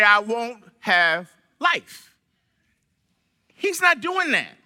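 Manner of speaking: ordinary talk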